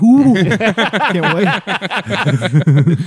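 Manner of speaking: high, squealing, can't-wait glee; giddy excitement. Retold or imitated. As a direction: gleefully rub your hands and squeal. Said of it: "He's like".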